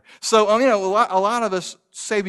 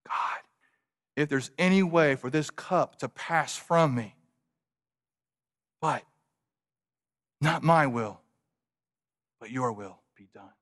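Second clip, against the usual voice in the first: hushed prayer; 4 semitones lower, 5 dB quieter than usual. Acted. Preacher voices Jesus' prayer softly.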